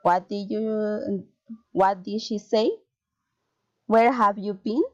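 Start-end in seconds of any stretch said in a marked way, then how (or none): 1.7-2.7 "say" lifted at the end; polite question, pitch climbing on "say"; rising intonation. Shown as a demonstration. Teacher models friendly interest, rising intonation on "say". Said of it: "rising intonation". 3.9-4.8 "been" lifted at the end; friendly question, pitch climbing on "been"; rising intonation. Shown as a demonstration. Teacher models friendly interest, rising intonation on "been". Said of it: "rising intonation".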